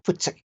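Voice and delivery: "Futsek" spat out with a sharp T S; "Futsek" said short and crisp, as if chasing off a dog; Afrikaans dog command. Shown as a demonstration. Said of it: "the dog reacts to the T S".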